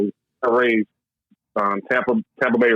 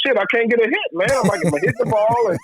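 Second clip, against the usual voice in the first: higher-pitched, exasperated griping; mock-annoyed young player venting; frustrated retold complaint; 10 semitones higher than usual. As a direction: whine that the hits keep getting caught. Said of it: "so I'm pissed off".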